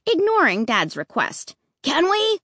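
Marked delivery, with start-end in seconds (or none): 1.8-2.4 high, nagging question; demanding. A narrator's reading. Boy keeps pestering Dad, demanding. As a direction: nag like a pushy kid. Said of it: "Luke demanded".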